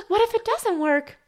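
high, loud mock-innocent question; girl's question. Quoting Eliza asking the suitor, mock-innocent. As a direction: ask it wide-eyed like the girl. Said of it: "quoting Eliza in dialogue".

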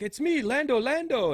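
high, loud eager shout of a name; loud excited greeting. Retold or imitated. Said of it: "he's like".

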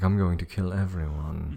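lightly voiced stage villain; a hint of a hammy stage actor; Shakespearean thespian. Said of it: "He sounded like a thespian from, like, Shakespeare".

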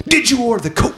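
very high, strained mock demand; movie-quote interrogation. Host acting out the film's courtroom scene, mock interrogation.

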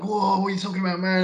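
put-on astonished protest; mock shock. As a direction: act out a taken-aback crowd. Acting out startled press reaction.

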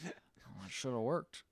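soft, whispered self-talk; hammed-up doctor mumbling; mock muttered aside. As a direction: mutter it under your breath. Doctor muttering in retold skit.

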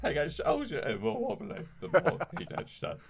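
high, trembling Caine voice; wobbly Michael Caine. Acted. Bit about Caine getting wobblier, high wobbly voice. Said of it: "your increasingly wobbly Michael Caine".

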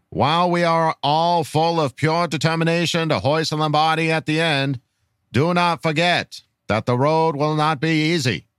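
put-on measured, old-fashioned presidential speech delivery; grand political orator. Reading a fan's rallying question to Charger Nation, grand oratory. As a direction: deliver a solemn, rousing address with dropped R's.

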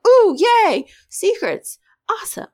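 thrilled by the secrets, squeal it; squealing excitement. Retold or imitated. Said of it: "And I was like".

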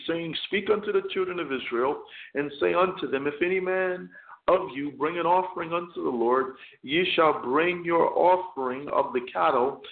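ordinary talk, nothing marked

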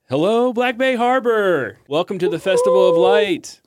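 loud, high frontman greeting a festival crowd; big high-pitched concert welcome; stage frontman. Acted. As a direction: belt it out to a whole harbor, stretching the words. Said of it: "he tips up his lyre and he's".

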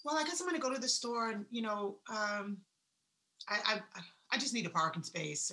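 soft, hesitant mumbling; unsure mumble. Retold or imitated. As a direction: sound unsure and half-hearted. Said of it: "If I say".

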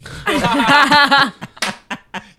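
big high laugh bursting out; loud burst of laughter. Real, not acted. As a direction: crack up loudly at the line.

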